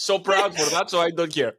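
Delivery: happy, proud. Real, not acted. Guest proud of the awards, happy and laughing. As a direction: laugh it off with giddy pride. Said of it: "because I'm so happy".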